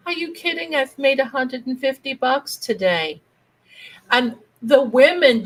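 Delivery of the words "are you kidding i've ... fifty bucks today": high, incredulous little voice; little aunt voice. Retold or imitated. Aunt answering grandmother and the others, high little voice. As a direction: pipe up high as the gleeful aunt. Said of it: "And she's like".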